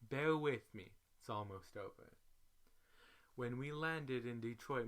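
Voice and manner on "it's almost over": plain reading voice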